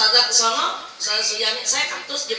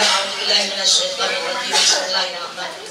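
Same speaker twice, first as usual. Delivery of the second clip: Quranic recitation; 2 semitones lower, 5 dB louder than usual. Real, not acted. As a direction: chant the opening formula, a bit louder. Opens Al-Fatihah recitation. (lantunan bacaan doa)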